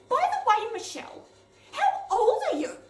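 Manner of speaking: put-on high, loud nervous little girl; blurting child. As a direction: blurt it out like a flustered kid.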